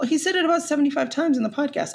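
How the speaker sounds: ordinary talk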